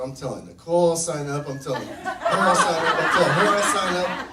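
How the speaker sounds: escalating, loud mock promise to everyone; building insistence. Promising each of three girls, escalating.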